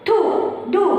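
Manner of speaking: थू and दू said as quick short syllables; short, fast vowel. Shown as a demonstration. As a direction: keep the oo in थू, दू brief. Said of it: "इस type से थोड़ा fast बोलेंगे". (थू, दू छोटे और फटाफट)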